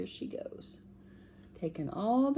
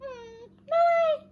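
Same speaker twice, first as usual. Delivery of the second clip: very high tiny mouse voice; squeaky mouse; slower than usual. Acted. Voicing the mouse.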